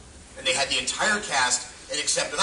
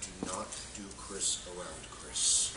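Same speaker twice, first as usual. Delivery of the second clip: low warning from crew; 7 semitones lower, 11 dB quieter, slower than usual. Retold or imitated. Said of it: "they come up and go".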